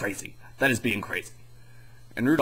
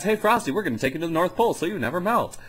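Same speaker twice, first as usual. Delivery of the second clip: put-on perky little girl; 7 semitones higher, faster than usual.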